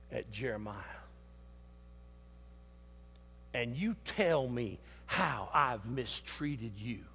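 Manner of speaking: hushed divine scolding; voice of God, quiet. Retold or imitated. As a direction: speak down to him low and hushed. God rebukes the preacher.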